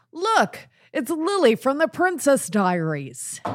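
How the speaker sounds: high, loud excited announcement. Retold or imitated. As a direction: show her off like a celebrity sighting. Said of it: "he said to her".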